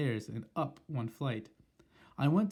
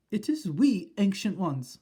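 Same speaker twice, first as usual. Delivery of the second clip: high, timid witch voice; meek voice; 8 semitones higher, 7 dB louder, slower than usual. Acted. Witches at the door addressing their leader meekly. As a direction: speak up nervously. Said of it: "said a meek voice".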